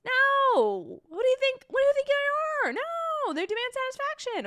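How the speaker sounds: very high, loud incredulous squeal; comic high-pitched indignation; squeaky mock outrage. Real, not acted. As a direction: protest in a shrieking falsetto pitch. Mock outrage at silly boys.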